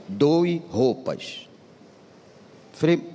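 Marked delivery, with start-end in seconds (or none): 0.1-1.5 God's small voice. Retold or imitated. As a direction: let the inner voice speak slowly. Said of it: "uma voz assim". (voz de Deus lenta e suave)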